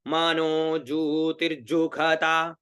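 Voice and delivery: very slow, every syllable stretched; unhurried mantra practice; slow recitation. Shown as a demonstration. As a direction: draw out मानो जू तिरजुखता slowly and evenly. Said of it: "उतना धीरे धीरे स्वर लगाओ".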